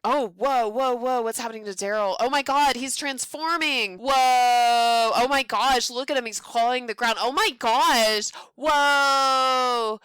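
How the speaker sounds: loud, high, astonished exclamations; real loud amazement at the screen; amazed shouting. Real, not acted. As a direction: shout in wonder as he transforms. Watching werewolf transform.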